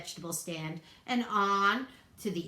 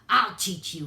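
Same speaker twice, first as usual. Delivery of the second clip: much louder angry howl; furious varmint threatening the bunny; 8 dB louder than usual. A narrator's reading. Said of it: "he howled".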